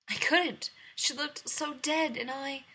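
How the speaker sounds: distressed. Acted. Frightened bride reporting failure.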